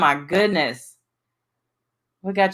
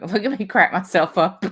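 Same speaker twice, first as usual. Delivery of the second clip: ordinary talk, nothing marked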